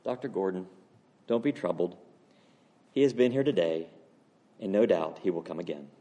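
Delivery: low, soft, soothing reply; calm reassurance. Acted. Man in the dream reassuring the troubled pastor, calmly.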